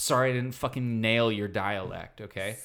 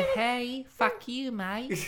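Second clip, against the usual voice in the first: high, cocky Sydney Aussie; Sydney accent; 10 semitones higher, much slower than usual. Shown as a demonstration. Comedian voicing a typical Sydneysider, high Sydney accent. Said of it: "It's kind of a".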